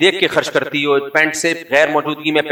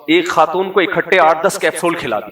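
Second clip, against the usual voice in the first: usual speaking voice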